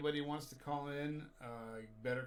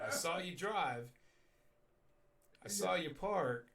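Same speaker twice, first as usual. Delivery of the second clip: slow, louder, stern officer; stern cop voice; 6 semitones higher, 5 dB louder, slower than usual. Retold or imitated. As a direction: lay down the law like a cop. Cop confronting drunk driver.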